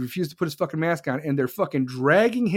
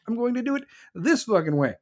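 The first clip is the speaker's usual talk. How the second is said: usual speaking voice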